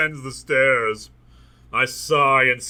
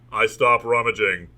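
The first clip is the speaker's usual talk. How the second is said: ordinary delivery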